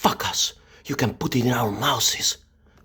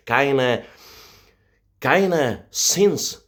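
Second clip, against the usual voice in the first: normal talking voice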